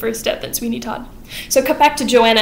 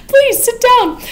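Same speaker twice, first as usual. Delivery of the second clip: much higher and louder, falsely sweet; sugary invite; 7 dB louder, slower than usual. Retold or imitated. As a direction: lure him in with a high sugary voice. Sweeney sweetly luring the judge.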